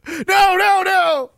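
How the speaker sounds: loud, very high cries of no; hammed-up frantic refusal; mock alarmed protest. Acting rescuer's protest.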